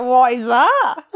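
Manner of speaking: slow, puzzled, laughing question; baffled. Retold or imitated. Retelling hearing pisser, puzzled and laughing. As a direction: laugh while asking what that means. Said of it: "I was like".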